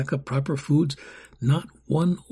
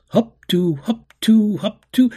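1 drill call; 8 semitones higher, 6 dB louder than usual. Acted. Orphans closing ranks, drill call.

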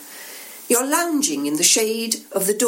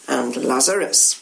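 low, knowing hint on Lazarus; significant; 5 semitones lower than usual. Acted. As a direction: let the name hang meaningfully. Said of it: "They nod together significantly".

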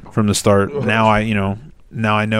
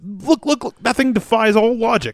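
higher mock Rick awe; lightly raised Rick voice, amazed; imitating Rick; 10 semitones higher than usual. Host quoting a favourite Rick line, lightly higher. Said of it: "how he says".

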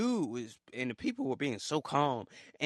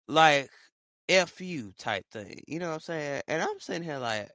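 normal talking voice

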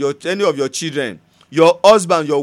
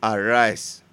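quiet prayer; 6 semitones lower, 3 dB quieter than usual. Acted. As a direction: pray it softly and earnestly.

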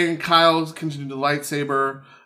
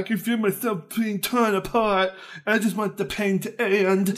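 higher, whiny melodramatic plea; mock anguished teen; mock emo villain; 6 semitones higher than usual. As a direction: ham up the tortured villain. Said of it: "And Kyle's like".

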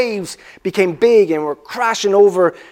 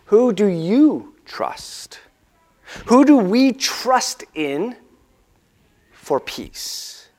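slow and drawn out, dropping to a breath; slow searching question; 2 semitones higher, 3 dB quieter, much slower than usual. Real, not acted. Pastor pressing the congregation, slow and hushed. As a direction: ask it slowly and let it hang.

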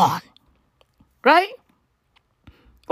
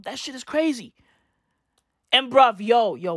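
ordinary talk, nothing marked

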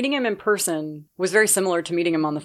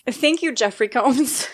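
slow, higher, overwhelmed thanks; breathless awe; 6 semitones higher, slower than usual. Retold or imitated. Starstruck fan thanking her idol on the phone. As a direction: squeak it out, starstruck. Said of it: "The only thing I could muster was".

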